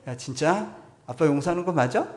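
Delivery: 되묻듯 크게, 반쯤 장난스럽게; 아들에게 정말이냐고 다그치듯 크게 묻기; 큰 소리로 확인. Retold or imitated. 아이에게 다그치듯 묻는 아빠. (아들에게 되묻는 아빠, 장난스럽게 큰 목소리)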